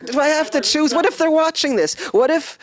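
high, anxious worried pleading; flustered, nervous rising voice; panicked. Real, not acted. Forced to choose, panicking.

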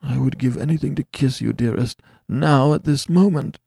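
a hint of mournful longing; yearning, lightly mournful; a husband holding back his longing, mildly mournful; mournful passion. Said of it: "he continued with mournful passionateness".

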